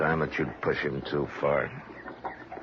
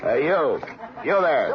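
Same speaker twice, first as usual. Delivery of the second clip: calling out; 10 semitones higher than usual. Acted. Marshal calling out to a distant rider.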